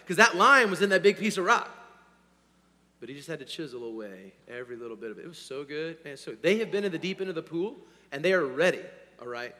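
usual speaking voice

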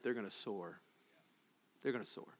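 soft and low, almost breathed; hushed hopeful repeat; gentle low echo; quiet promise. Acted.

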